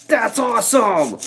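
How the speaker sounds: high, far-off surfer-dude voice, stunned; amazed teen dude. Retold or imitated. Teenager gawking at the narrator's car entrance, high surfer-dude voice.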